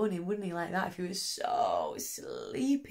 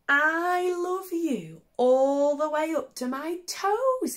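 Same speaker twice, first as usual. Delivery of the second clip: high, slow little hare; lightly voiced little hare, loud and proud; 8 semitones higher, 6 dB louder than usual. Little hare telling daddy his love, high and slow. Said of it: "he said".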